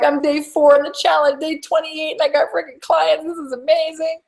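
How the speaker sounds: high, giddy imitation of a delighted client; thrilled client. Coach imitating a client thrilled by new customers, high and giddy. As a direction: bubble over like a client who just landed customers. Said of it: "they're like".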